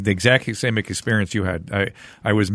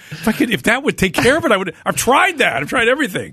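high-pitched, exasperated protest; retold frustration, voice pitched way up; exasperated retort; 11 semitones higher than usual. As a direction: protest it high, at wit's end. Retelling retort to dismissive doctor.

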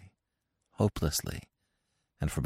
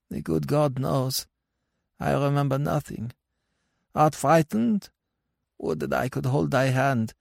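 tender dead husband; 9 semitones higher than usual. Acted. Husband longs to hold frightened wife's hand, gently voiced. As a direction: comfort her in a raised, lingering voice.

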